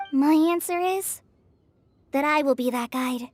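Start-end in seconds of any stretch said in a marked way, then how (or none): none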